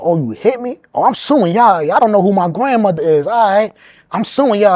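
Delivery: loud, high cocky threats; arrested man taunts the police, loud and cocky; talking trash. Retold or imitated. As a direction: mouth off at the cops. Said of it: "I'm talking hella shit".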